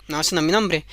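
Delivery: low, smug refusal; the goblin rejecting the queen's guess. Acted. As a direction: answer low and unbothered.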